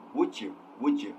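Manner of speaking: "Would you" run together as "wu-jya"; "Would you" with a j in the middle; linked "would you". Shown as a demonstration. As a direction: blend the d and y of "Would you" into a j sound. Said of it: "it is typically pronounced as".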